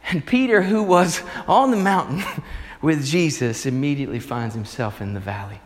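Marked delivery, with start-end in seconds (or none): none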